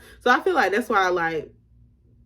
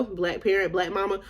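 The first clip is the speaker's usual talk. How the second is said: ordinary talk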